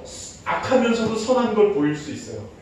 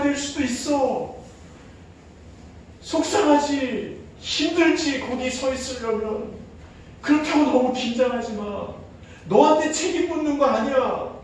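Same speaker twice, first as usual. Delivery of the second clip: earnest preaching; 5 semitones higher, 4 dB louder than usual. Acted. Preaching God's reassurance.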